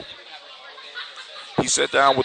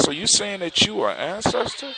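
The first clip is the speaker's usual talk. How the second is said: high, incredulous storybook question; understated shock from the prince; 8 semitones higher, 3 dB quieter than usual. A narrator's reading. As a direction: ask it in mild, high-pitched disbelief. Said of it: "the prince was shocked".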